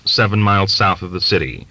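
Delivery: usual speaking voice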